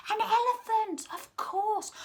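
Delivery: high, excited exclamation; delighted reveal. Real, not acted. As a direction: burst out with the answer. Answers own question to children.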